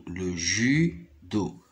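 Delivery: judo drawn out slowly, syllable by syllable. Shown as a demonstration. Teacher to pupils, spelling out judo slowly. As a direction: stretch out the word judo for the class. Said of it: "Regardez ici, en rouge, U, c'est le son U".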